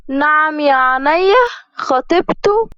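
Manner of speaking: high rising outrage on خطيبته; shocked disbelief. Acted. Bride hearing he has a fiancée, high incredulous rise. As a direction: gasp it in disbelief.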